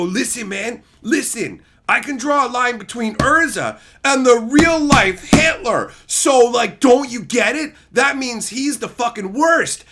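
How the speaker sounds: high, loud whiny rant; mocking outraged fan. Mocking a fan ranting about a villain. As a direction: rant like a shrill online critic. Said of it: "But people will go".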